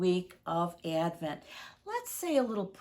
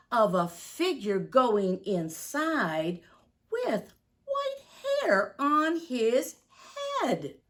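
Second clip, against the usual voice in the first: building storybook reveal, slow and louder; reading to children; 3 semitones higher, 5 dB louder, slower than usual. Acted. Reads the children the moment Santa appears, building storybook reveal. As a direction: unveil Kris Kringle like a secret. Reads picture book aloud.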